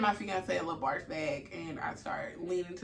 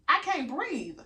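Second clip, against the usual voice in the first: raised pitch, emphatic exasperation; indignant insistence; 7 semitones higher, 4 dB louder, slower than usual. Retold or imitated. Patient dismissed as anxious, indignantly insisting. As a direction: spell it out to someone not listening.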